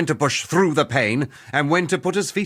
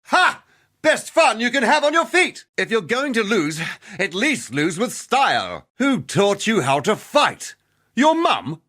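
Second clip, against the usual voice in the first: high-pitched, crowing battle taunt; combat taunt; 8 semitones higher than usual. Acted. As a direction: goad your opponent mid-fight, pitch leaping up. Taunting an opponent mid-fight.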